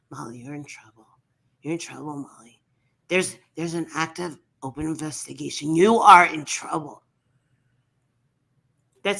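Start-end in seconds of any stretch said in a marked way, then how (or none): 0.1-7.0 low mock-serious streamer threatening Molly; imitating Michelle's stern voice. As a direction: lower the voice and warn her gravely. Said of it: "she talks like this".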